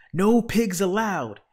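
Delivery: loud, high, drawn-out yell; outraged storybook bellow; angry shout. A narrator's reading. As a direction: holler the rule slowly and loudly. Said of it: "Eugenia shouted".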